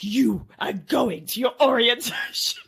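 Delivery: slow, emphatic mock order cracking into laughter; fed-up parent order. Mum ordering injured son to orientation, mock-stern insistence. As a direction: lay down the law slowly, then crack up.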